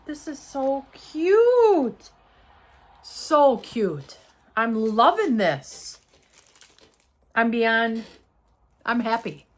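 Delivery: loud, drawn-out gushing; excited. Real, not acted. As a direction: stretch the words with sheer delight. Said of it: "'cause I'm excited".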